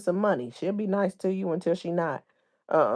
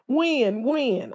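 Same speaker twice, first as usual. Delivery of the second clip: high, falling mock question; lightly mocking repeated question; mocking feigned ignorance; 7 semitones higher than usual. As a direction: imitate someone playing clueless, pitched up. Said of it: "to be like, "When, when?".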